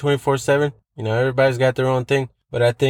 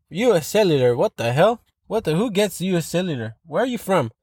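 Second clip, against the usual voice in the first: ordinary talk, nothing marked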